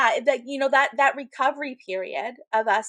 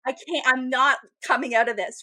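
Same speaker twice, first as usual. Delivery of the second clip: louder, mock-hopeless declaration; overly dramatic; 3 semitones higher, 5 dB louder than usual. Woman retelling her labor despair, overly dramatic. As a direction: declare your doom with hammed-up force. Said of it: "it's so overly dramatic".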